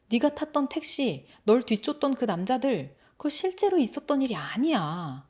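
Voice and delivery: firm, full-voiced insistence; insistent calming. Brother pinning the boy down to calm him, insistent. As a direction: press the point to talk him down. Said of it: "꽉 눌러서 진정을".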